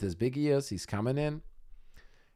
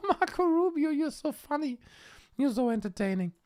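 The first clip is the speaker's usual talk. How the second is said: high, breathy-free fake girl voice, much higher; swooning girl. Imitating the girl charmed by Rubio, high mock gushing. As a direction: gush like a smitten girl.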